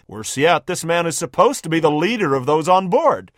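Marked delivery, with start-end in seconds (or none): none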